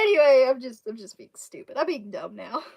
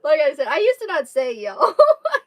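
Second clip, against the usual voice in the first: laughing; 5 semitones higher, 13 dB louder than usual. Real, not acted. Laughing, fast.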